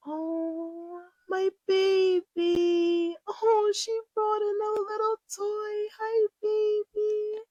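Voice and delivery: high, sing-song cooing at her cat; cooing pet voice. Real, not acted. Cooing high at her cat Starlight to show viewers.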